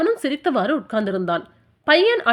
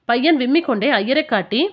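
usual speaking voice

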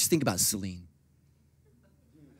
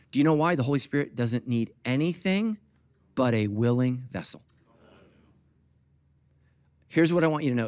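normal talking voice

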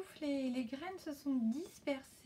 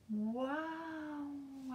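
excited wow; 3 semitones higher than usual. Acted. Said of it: "a crié Anna".

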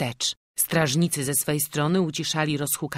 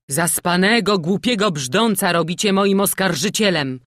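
loud, higher, bitter protest; 4 semitones higher, 7 dB louder than usual. A narrator's reading. The prisoner objects to his child accuser, bitterly. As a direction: throw it back at the accusers with bitterness. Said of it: "z goryczą rzekł pojmany".

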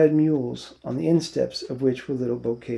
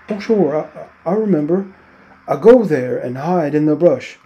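higher, louder eager burst; 3 semitones higher, 9 dB louder than usual. A narrator's reading. Lenny eagerly telling George he remembers the hiding place. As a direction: blurt it out proudly.

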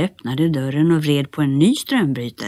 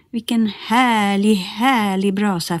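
slow delight; 5 semitones higher than usual. Acted. Nisse delights by the fire.

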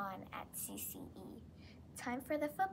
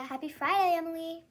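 excited greeting; 6 semitones higher, 10 dB louder, faster than usual. Real, not acted. Cheerful on-air greeting to co-host.

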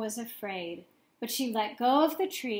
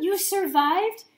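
higher and louder, overjoyed; excited cry; 8 semitones higher, 7 dB louder than usual. A narrator's reading. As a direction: call out in happy disbelief. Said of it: "cried Stella Luna".

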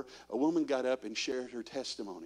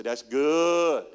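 louder, folksy Southern drawl on That's good; Andy Griffith drawl; 6 dB louder than usual. Shown as a demonstration. Congregation told to say it like Andy Griffith, Southern drawl. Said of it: "Say it like Andy Griffith".

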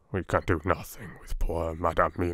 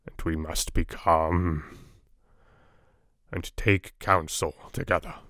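wonderful calmness; 3 semitones lower, slower than usual. A narrator's reading. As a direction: soothe them, slowly and gently. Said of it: "said with wonderful calmness".

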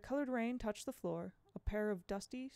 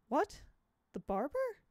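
shocked; 8 semitones higher, 4 dB louder than usual. Acted. Miss Foley shocked by news.

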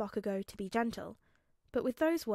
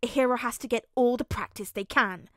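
put-on loud, eager child's declaration; grinning praise; 8 dB louder than usual. A narrator's reading. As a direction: proclaim it proudly through a grin. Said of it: "Bakugo praised with a grin".

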